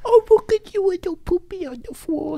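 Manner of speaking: very high, cutesy put-on voice; pet baby talk. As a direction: coo at a puppy in squeaky baby talk. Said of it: "start talking like that to it".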